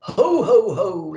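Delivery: a jolly Santa belly laugh. A narrator's reading. Santa laughing as the sleigh takes off. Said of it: "laughed Santa".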